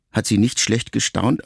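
ordinary delivery

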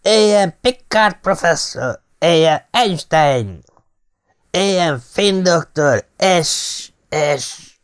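put-on high, slow drunken cheers; drunken toasting. Drunk Ellen cheers names at the table, high and slow. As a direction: toast each name slowly, raising your voice.

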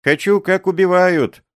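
high, loud excited plea; eager child. A narrator's reading. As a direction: beg eagerly.

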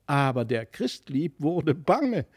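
plain reading voice

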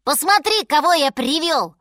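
high, loud cub showing off a find; childlike high-pitched calling; excited shout. A narrator's reading. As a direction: call out proudly to mother. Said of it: "крикнул ей медвежонок".

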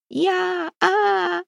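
very high, drawn-out wailing cries; terrified wail. A narrator's reading. Captive tries to answer Демка, terrified wail. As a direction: break from the word into wails.

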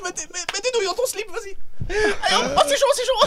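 high, fast hyped TV host shout; Cyril Hanouna impression. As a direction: hype the crowd like a rowdy talk show host. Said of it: "cinq secondes de Cyril Hanouna".

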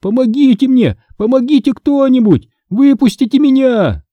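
high, panicked shouts for help; shrill frightened cries. Acted. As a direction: pound the glass and beg to be let out. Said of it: "Мой дрожащий пронзительный голос". (дрожащий пронзительный крик о помощи)

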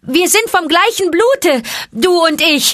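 high, loud ringing call; snake call. A narrator's reading. Mowgli calls to the snakes, high and loud. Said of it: "den Schlangenruf gebend".

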